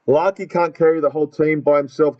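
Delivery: ordinary talk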